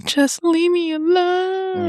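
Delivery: very high slow whiny mimic; mocking high voice. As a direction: echo it back in a squeaky mock. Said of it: "echoes in mock voice".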